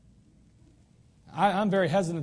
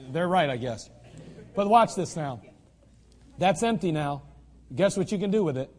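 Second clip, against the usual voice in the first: ordinary talk, nothing marked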